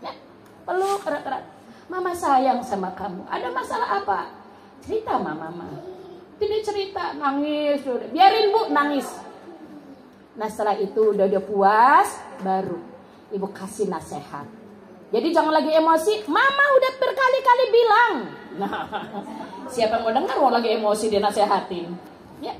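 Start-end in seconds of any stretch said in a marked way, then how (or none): 1.9-5.7 softer, hushed loving mother; whisper-soft comfort to a crying child; tender and soft. Acted. 16.3-18.1 much higher, exasperated nagging; nagging mother. Acted. Mother nagging upset child.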